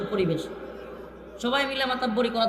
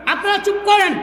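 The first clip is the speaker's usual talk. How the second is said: higher, louder call to hush the crowd; 6 semitones higher, 4 dB louder than usual. Real, not acted. Preacher calls out over a noisy crowd, louder and higher. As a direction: raise the voice over the noise.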